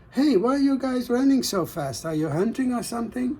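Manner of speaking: high, quick weaver calling; curious weaver. Acted. Weaver calling to running men, high and quick.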